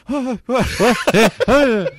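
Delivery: loud, high mock yelps; mock startled shout. Storyteller imitating Jonah in the basement, mock startled shout.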